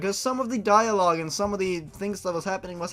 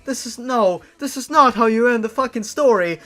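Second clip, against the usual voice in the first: loud, high disbelieving rant; half-laughing cries of disbelief; exasperated outburst; 6 semitones higher, 9 dB louder than usual. Real, not acted. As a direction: rant in real shock at the ending. Reacting in disbelief to story ending.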